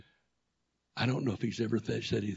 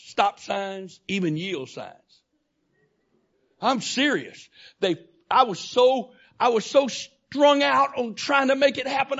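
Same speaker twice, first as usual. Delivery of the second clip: rising from firm to loud, higher preaching; preaching, building up; 12 semitones higher, 11 dB louder than usual. Acted. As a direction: build the sermon up to a shout.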